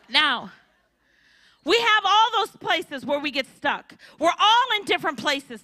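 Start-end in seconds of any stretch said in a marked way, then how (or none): none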